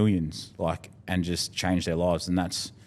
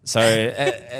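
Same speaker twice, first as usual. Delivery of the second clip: normal talking voice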